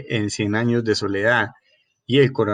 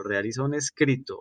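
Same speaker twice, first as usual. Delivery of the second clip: a softer echo, stretching the final "escrito"; softer repeat; 6 dB quieter than usual. Shown as a demonstration. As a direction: repeat "realiza un escrito" quietly, letting "escrito" trail. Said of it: "ojo pues".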